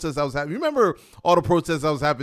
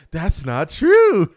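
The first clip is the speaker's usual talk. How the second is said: slow, loud, higher indignant "That's not true"; angry denial; 5 semitones higher, 7 dB louder, much slower than usual. Retold or imitated. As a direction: drag it out like an offended coworker. Angry coworker retorts.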